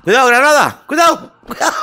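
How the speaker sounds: high, loud panicked warning shout; grenade warning yell. Real, not acted. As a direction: yell it to warn your teammate. Grenade lands during shooter game.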